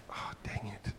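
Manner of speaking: breathy whispered letdown; disappointed whisper. Retold or imitated. Boy realizing he lost his award, whispered disappointment. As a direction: whisper it as the heart sinks. Son's face falls, disappointed.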